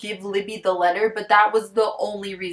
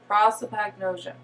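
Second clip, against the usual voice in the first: ordinary talk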